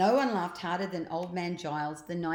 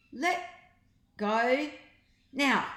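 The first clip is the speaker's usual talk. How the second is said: high, louder, spaced-out command; 6 semitones higher, 5 dB louder, slower than usual. A narrator's reading. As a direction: order it firmly, word by word. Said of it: "commanded Ned".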